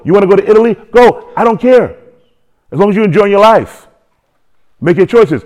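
preaching fervor. Real, not acted. Preaching builds to loud.

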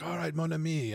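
a lightly voiced French ally; French accent. French game character quoted.

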